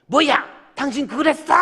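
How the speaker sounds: high loud mock angry wife. Imitating a wife snapping at her husband, angry. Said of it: "막 화를 막 내는 사람".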